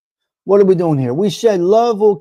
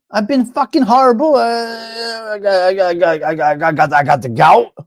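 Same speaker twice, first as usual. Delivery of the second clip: mock moaning, stammering complainer; whining oversharer; 2 semitones higher than usual. Oversharer answering how you been, mock whining. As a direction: stammer through a hammed-up groan of woe.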